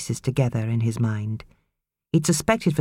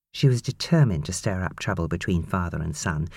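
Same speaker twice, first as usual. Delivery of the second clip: ordinary delivery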